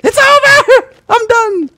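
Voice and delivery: very high, very loud shriek; despairing scream. Real, not acted. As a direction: scream in utter defeat. Screamed, then repeated calmly.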